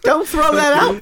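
giggly. Real, not acted. Still giggling at prank.

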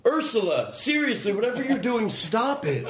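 high-pitched scolding of the dog; half-laughing, scold Ursula from up high; mock scolding. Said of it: "there was a picture of a woman".

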